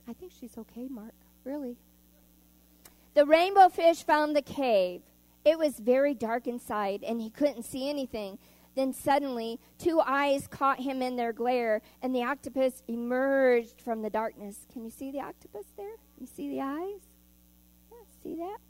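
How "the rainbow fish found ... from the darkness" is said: put-on storybook reading to kids in church; gentle storyteller voice; story narration. A narrator's reading. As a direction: read it out like a picture book for children. Reads picture book to children.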